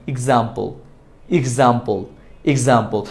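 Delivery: "এক্সাম্পল" starting with i, ig-zam-pol, low and falling; correct pronunciation drill. Shown as a demonstration. Teacher drills the corrected word, correct pronunciation. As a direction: drill "এক্সাম্পল" with an opening "ig", pausing between. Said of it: "এক্সাম্পল হবে না".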